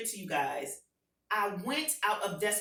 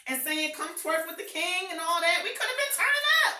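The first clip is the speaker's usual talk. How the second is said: much higher and louder, building to a shout; rally the crowd like a revival preacher; hyped call to the congregation; fired-up preaching; 7 semitones higher, 5 dB louder than usual. Acted. Preaching at full force.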